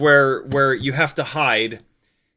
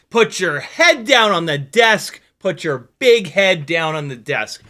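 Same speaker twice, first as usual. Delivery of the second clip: stand-up quote shouted; 5 dB louder, slower than usual. Retold or imitated. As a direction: bellow it like an angry comic. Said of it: "that is a quote".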